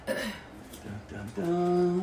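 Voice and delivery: ordinary talk, nothing marked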